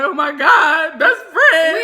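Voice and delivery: loud, high imitation of a wail; mock crying. Storyteller crying to her best friend, mock wailing. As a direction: wail it as a dramatized tearful cry. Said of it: "I'm, like, crying".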